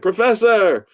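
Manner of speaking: high, loud hail, falling at the end; called-out greeting. Retold or imitated. Attorney greets the author on the phone, calling out high and loud. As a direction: hail an old pal by title.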